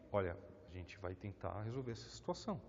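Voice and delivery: calm voice. Shown as a demonstration. Paulo calmly resolving the situation, soft and calm. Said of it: "tom de voz um pouco mais calmo".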